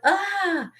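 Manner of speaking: high retold cry of alarm; mock scream. Imitating the janitor in a childhood story, mock scream. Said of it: "and he went".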